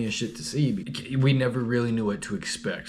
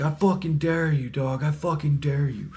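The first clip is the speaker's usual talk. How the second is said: mild mock taunt; 5 semitones higher than usual. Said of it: "he's just talking all this shit".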